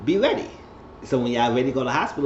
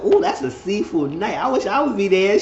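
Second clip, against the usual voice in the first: raised, eager exclamation; higher-pitched, wishing out loud; wistful excitement; 8 semitones higher, 4 dB louder than usual. Retold or imitated. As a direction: ooh with playful longing. Voicing her envy at seafood night.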